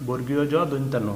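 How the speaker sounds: usual speaking voice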